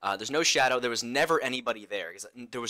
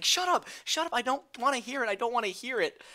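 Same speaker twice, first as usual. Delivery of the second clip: much higher, frantic; panicked pleading; 13 semitones higher than usual. Retold or imitated. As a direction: cover your ears and beg. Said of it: "I'm just like".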